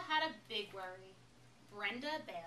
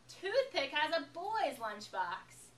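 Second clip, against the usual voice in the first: slow, loud mocking announcement; taunting; 5 semitones higher, 7 dB louder, slower than usual. A narrator's reading. Brenda announcing to the cafeteria, loud slow mockery. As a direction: broadcast the insult to the room. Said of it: "Brenda said to everyone".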